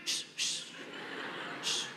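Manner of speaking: short unimpressed "shh" to shoo; curt hushing noise, no voice; dismissive shooing hiss. Acted. Men shooing a puppy, dismissive hiss.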